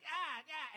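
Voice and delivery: high yelps while swinging at a spider; panicked mock cry. Comic retelling a spider fight, panicked mock cry.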